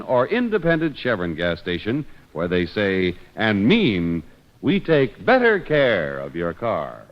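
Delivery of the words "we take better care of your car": ordinary talk, nothing marked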